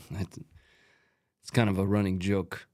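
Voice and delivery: ordinary delivery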